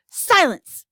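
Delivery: loud, high sharp command; snapped. Acted. President silencing the army chief, a sharp snap. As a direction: cut them off with one bark. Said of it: "snapped the president".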